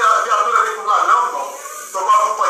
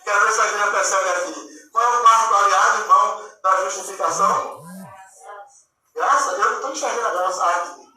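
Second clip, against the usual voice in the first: usual speaking voice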